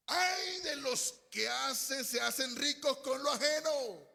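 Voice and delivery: loud, high, drawn-out cry of woe; exclamatory reading. Shown as a demonstration. Preacher shows how exclamation marks change the reading, loud cry. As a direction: read it with full exclamation marks, shouted and stretched. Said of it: "Lea con los signos de admiración".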